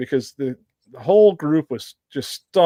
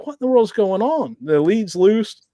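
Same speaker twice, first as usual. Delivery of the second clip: pitched-up, bewildered retelling; retold bafflement; 7 semitones higher than usual. Tracker recalls hearing brush tussling, retold bafflement. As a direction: replay the puzzlement, voice jumping higher. Retells alarm at leash on ground.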